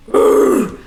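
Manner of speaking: guttural unvoiced growl; low metal scream. Shown as a demonstration. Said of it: "low screams where I go".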